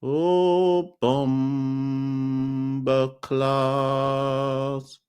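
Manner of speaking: hamming up the chat names; playful sing-song. Pretend. Greeting chatters in a stretched mock voice.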